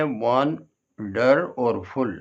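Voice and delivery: वन, डर, फुल as three slow beats; syllable by syllable. Shown as a demonstration. Teacher counting three syllables in wonderful, one by one. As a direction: separate वन, डर and फुल with short pauses. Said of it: "इसमें भी तीन syllable हैं".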